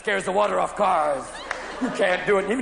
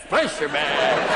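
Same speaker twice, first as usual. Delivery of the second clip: low, slow croupier cry; croupier call; 7 semitones lower, much slower than usual. Acted. Spinning room becomes roulette, drawn-out croupier call. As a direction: stretch the call out long and low.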